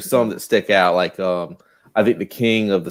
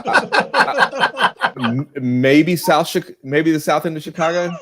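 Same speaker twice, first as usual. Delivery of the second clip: giggly guess, pitch climbing higher; laughing while guessing; 8 semitones higher, slower than usual. Real, not acted. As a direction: laugh through the guess about Chicago. Laughing while guessing Marco's hometown.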